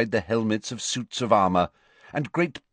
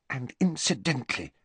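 put-on higher, softer girl's voice; haughty schoolgirl feigning indifference; prim Hermione; 5 semitones higher, 5 dB quieter than usual. A narrator's reading. Hermione voice again.

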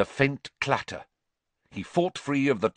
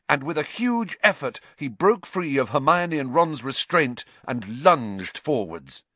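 ordinary delivery

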